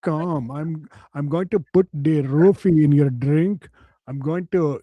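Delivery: mock Indian accent, slow in places; man boasting of his fantasy in a mock Indian accent.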